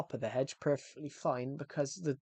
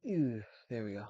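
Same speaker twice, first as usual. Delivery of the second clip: talking through burps; words broken by a burp; 6 dB quieter than usual. Real, not acted. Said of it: "Sorry, I keep burping".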